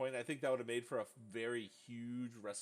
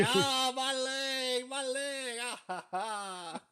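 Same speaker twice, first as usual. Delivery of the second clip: mock pained wail; 12 semitones higher, 9 dB louder, much slower than usual. Mocking Shawn's pained reaction.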